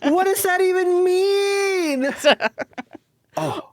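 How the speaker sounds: high, slow words through laughter; squeaky voice through laughter; laughing. Real, not acted. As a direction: talk while cracking up. Laughing after a joke.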